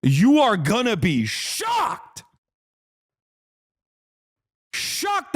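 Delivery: slow, sarcastic fake announcement; hammed-up sarcastic tease; mock warning. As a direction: ham up a fake warning, then squeak the last word high.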